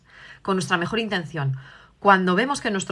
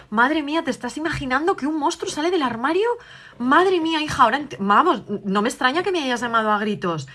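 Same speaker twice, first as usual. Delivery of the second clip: empathetic mother; 5 semitones higher than usual. Acted. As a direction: share the child's fright to comfort her. Madre valida el miedo de la hija. (la madre valida el miedo de su hija, voz aguda y empática)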